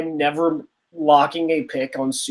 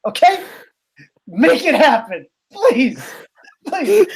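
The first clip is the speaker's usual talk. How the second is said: playful pleading; 7 semitones higher than usual. Real, not acted. Begging for a dream matchup.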